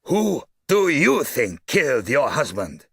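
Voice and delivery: slow, loud, high-pitched demand; loud, emphatic. A narrator's reading. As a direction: hammer out each word of the question. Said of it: "in a loud, emphatic voice".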